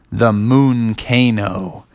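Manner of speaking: slow, drawn-out announcement; slow, portentous naming; momentous reveal. Acted. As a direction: stretch it out like a big secret. Frogs reveal mysterious volcano.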